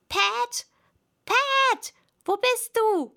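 high, loud, irate shout; annoyed rabbit yelling; angry calling. A narrator's reading. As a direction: call crossly for a servant. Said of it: "kam eine ärgerliche Stimme".

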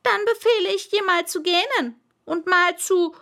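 high, loud royal voice, faltering; flustered king losing his thread; pompous king stumbling over his order; stammering annoyed king. Acted. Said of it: "er stammelte ein wenig und schien verärgert".

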